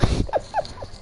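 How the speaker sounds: short breathy giggle; quick soft chuckle without voice; amused little laugh. Real, not acted. Amused by chat message.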